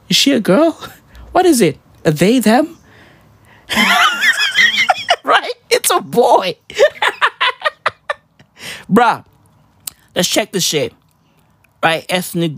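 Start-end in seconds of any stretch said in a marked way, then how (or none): 3.7-5.2 high squealing laugh; shrieking laugh. Real, not acted. Laughing at his own joke, high shriek. 6.8-8.1 high giggle; squeaky laugh. Real, not acted. Laughing at his own joke, high giggle.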